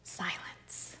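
whispered, barely audible; a single whispered word; hushed whisper. Acted. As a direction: breathe the word out to hush the room. Dramatic pause in story.

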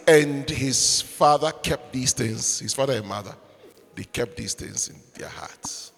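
from soft reading down to a whisper; fading to whisper. Acted. Preacher to congregation, fading into a whisper. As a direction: let the verse sink into a hush.

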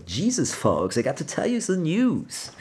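high, exaggerated German-accented delivery; German guys' English. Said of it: "that's how some German guys speak".

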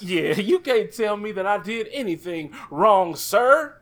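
high, overly polite proper voice with a laugh. Retold or imitated. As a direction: put on a super polite proper voice.

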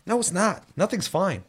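ordinary talk, nothing marked

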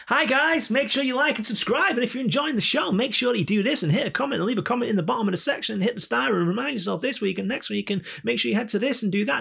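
very high, sing-song, fake YouTuber spiel; cheesy YouTuber voice. YouTuber urging viewers to like and subscribe, cheesy fake voice. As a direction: rattle through a put-on subscribe plea. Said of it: "that YouTube way of talking".

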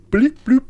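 high, loud bleep bloop like a beeping computer; computer beeps. Retold or imitated. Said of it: "computer going off".

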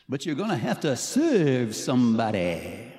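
loud, slow, hammed-up singer parody; bad impression of a famous singer, drawn-out and loud. Shown as a demonstration. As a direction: stretch it out and belt it for the crowd. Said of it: "say it as [name]".

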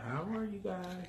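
put-on soft high prompt for a toddler; teaching a parrot. Shown as a demonstration. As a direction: model the words gently for a child to copy.

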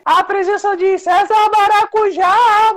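slow, squeaky high voice; chipmunk voice. Pretend. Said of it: "bora encerrar com a voz fina".

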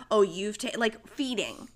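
ordinary delivery

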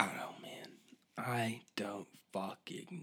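tired whispered shrug. Real, not acted. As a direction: breathe it out, giving up. Exasperated trailing off.

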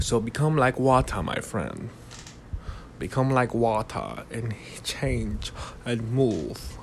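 put-on lightly done Bruce Lee accent, slow and soft; a hint of a wise Bruce Lee. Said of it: "I'm doing Bruce Lee right now".

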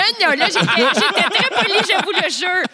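talking through laughter; laughing. Real, not acted. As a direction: laugh while protesting innocence. Laughing while defending herself.

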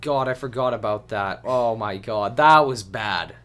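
loud burst of recollection; real loud dismay; exclaiming. Real, not acted. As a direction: blurt it out as it comes back to you. Suddenly recalling a bad moment.

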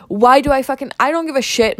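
venting, truly fed up with planks; real irritation. Real, not acted. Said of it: "just getting pissed off".

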